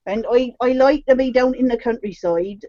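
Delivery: put-on countryside English accent; playful rustic English accent demo; rural English accent. Shown as a demonstration. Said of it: "depends where you want me to be from".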